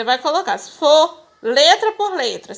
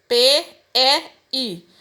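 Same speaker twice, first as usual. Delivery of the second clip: "P E I" spelled out slowly; name each letter of "P E I" separately, with gaps; slow, spaced-out letters in "P E I"; slower than usual. Shown as a demonstration. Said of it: "eu tenho sublinhado o pei".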